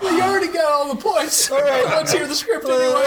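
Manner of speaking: high, laughing through the words. Real, not acted. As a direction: still laughing while talking. Host laughing at song.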